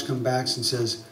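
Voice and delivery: normal talking voice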